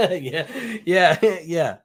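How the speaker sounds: laughing through the yeahs. Real, not acted. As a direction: chuckle out the words.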